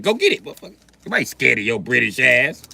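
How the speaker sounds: loud American dismissal; defiant dare. Acted. Americans daring the British gunman, loud defiance. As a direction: dare him at full voice. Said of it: "We'd be like".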